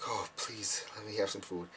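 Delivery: soft plea under the breath; quiet begging. Retold or imitated. Hungry guest waiting at Bethel, quiet begging. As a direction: beg softly, almost to yourself.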